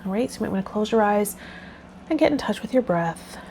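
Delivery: ordinary delivery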